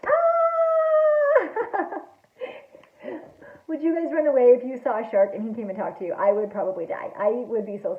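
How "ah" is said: long high-pitched mock scream. A narrator's reading. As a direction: scream in playful terror at a shark. Witness screams at shark.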